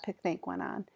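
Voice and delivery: ordinary delivery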